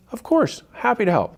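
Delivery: mock bright of course; cheerful agreeable. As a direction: sound glad to be asked. Polite agreeable reply voiced.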